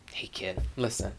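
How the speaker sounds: quiet, gentle hush; soft whisper. Acted. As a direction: lean in and whisper kindly. Father confides to daughter.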